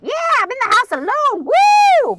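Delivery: high, loud celebratory yell; gleeful whoop. Retold or imitated. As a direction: whoop like she's partying alone. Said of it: "I'm just up like".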